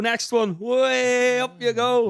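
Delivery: long whooping "way" as each one jumps; re-enacting a crowd catching jumpers; playful calling out.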